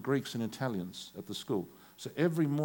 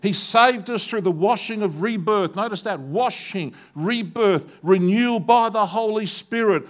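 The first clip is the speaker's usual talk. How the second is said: loud, high, slow fervent preaching; full-force preaching; 8 semitones higher, 11 dB louder, slower than usual. Acted. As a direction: thunder out the scripture to the church.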